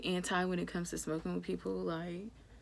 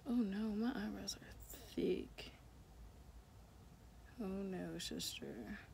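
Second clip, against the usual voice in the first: breathy whisper to herself, much softer; whispered aside; 11 dB quieter, slower than usual. Real, not acted. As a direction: mutter a quick aside under your breath. Aside to self on camera.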